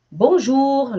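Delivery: bonjour said the French way. Shown as a demonstration. Teacher modeling bonjour for students. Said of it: "ele vai assim".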